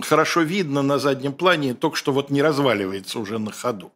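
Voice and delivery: usual speaking voice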